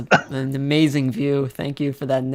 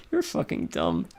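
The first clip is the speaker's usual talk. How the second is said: laughing; 9 semitones lower, 10 dB quieter than usual. Real, not acted. Laughing at a friend after a prank.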